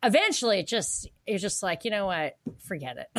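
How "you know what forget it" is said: dropping low and soft; mock giving up. Voicing someone worn down by mockery, giving up quietly. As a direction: toss it away under the voice, mimicking defeat.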